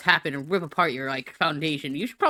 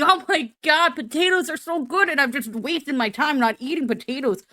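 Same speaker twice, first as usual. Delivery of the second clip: potato self-pep talk; 8 semitones higher, 4 dB louder than usual. Acted. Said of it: "think, "Come on, [name]".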